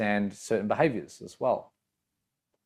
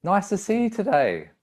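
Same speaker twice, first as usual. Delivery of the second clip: friendly pitch up; 9 semitones higher than usual. Shown as a demonstration. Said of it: "The pitch kind of goes up".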